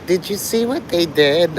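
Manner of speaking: high whiny mockery of a streamer; mock whining Mark. Mocking Mark calling for his daddy Johnny, whiny and high. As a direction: whine like a spoiled kid calling his dad. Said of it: "ranting and raving to all".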